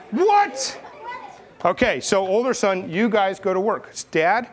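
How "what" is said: high, exaggerated put-on "what"; hammed-up disbelief; mock shock. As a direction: shoot way up in pitch, comically stunned. Demonstrates older son's shocked reaction.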